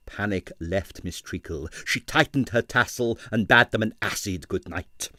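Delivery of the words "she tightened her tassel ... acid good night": plain reading voice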